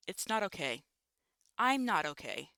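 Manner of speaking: a touch of distraught crying out; upset outburst. Acted. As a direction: let it burst out, not holding it in. She breaks down emotionally.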